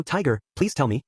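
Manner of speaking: ordinary delivery, nothing marked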